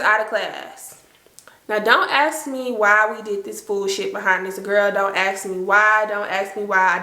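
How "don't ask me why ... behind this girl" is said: ordinary delivery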